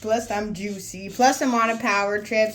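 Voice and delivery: loud and hyped up; excited. Real, not acted. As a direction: brag it out loud.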